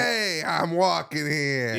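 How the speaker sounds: drawn-out New York protest with attitude; cocky, slow New York accent. Acted. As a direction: stretch it slowly, full of attitude. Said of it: "The attitude's what I like".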